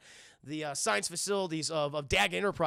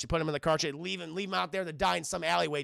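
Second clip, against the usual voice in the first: ordinary talk, nothing marked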